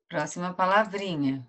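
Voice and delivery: normal talking voice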